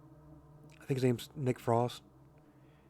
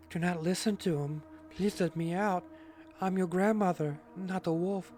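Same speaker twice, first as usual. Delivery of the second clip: high-pitched put-on grandma; pleading grandma character; 7 semitones higher than usual. Grandma begs to be let out, high pleading character voice. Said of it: "about to go to a different character".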